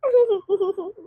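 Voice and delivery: soft amused chuckle, held back; quiet laugh. Acted. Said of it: "मुझे हंसी आ रही है वैसे". (धीमी दबी हंसी)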